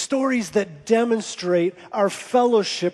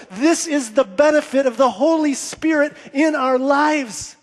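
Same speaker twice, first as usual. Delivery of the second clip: raised, rousing sermon cadence; impassioned preaching; 6 semitones higher, 3 dB louder than usual. Real, not acted. Preacher celebrating fellowship with the church, impassioned preaching. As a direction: build the congregation up with each phrase.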